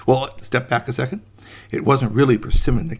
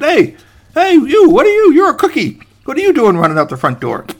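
startled calling out; 8 dB louder than usual. Acted. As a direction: holler after a runaway cookie. Gardener calls out to runaway cookie.

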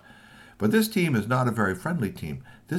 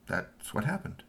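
usual speaking voice

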